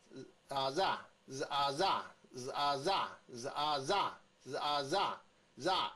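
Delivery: 日啊，ra as buzzed z, ah, then punched za; sound blending. Shown as a demonstration. Teacher drills the Z sound with vowels, blending drill. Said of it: "Z的声音是日".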